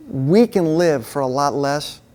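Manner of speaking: usual speaking voice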